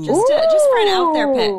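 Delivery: excited. Real, not acted. Bold pick announced loudly.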